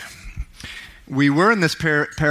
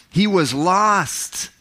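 emotional cry; 7 semitones higher, much slower than usual. Acted. Father's emotional climax.

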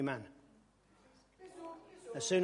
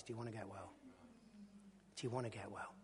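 much softer, lower, tender question; gentle asking; 5 semitones lower, 17 dB quieter, faster than usual. Retold or imitated. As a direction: ask them quietly and tenderly. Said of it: "he's asking you guys".

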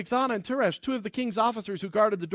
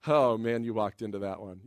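much lower, understated teasing; amused God; 10 semitones lower, 3 dB quieter than usual. Acted. God watching Haman trap himself, low amused teasing. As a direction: drop low, like someone relishing the trap. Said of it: "can you not see God just going".

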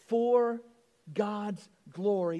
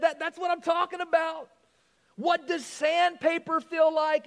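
eager owner; 7 semitones higher, 3 dB louder than usual. Acted. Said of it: "the owner said".

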